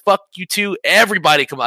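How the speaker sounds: fired-up retelling of screaming; retold screaming challenge. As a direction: challenge the whole crowd, half-yelled. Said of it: "at this point, I'm screaming".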